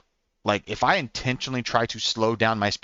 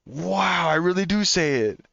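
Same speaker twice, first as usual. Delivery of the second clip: high, drawn-out wow of realization; amazed realization; 7 semitones higher, slower than usual. Retold or imitated. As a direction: let it dawn on you, pitched up high. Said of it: "realization of what I just said hit me".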